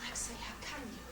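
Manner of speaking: breathless whisper of dismay; a wife whispering in shock at her husband. Acted. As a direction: hush it out, aghast.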